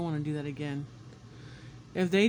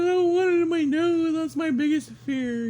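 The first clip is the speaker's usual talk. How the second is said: high, louder pleading voice; frightened protest; 10 semitones higher, 6 dB louder than usual. Retold or imitated. Telling the nurse no swab in the nose, frightened pleading. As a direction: beg like it's your worst fear.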